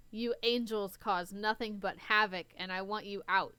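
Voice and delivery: ordinary talk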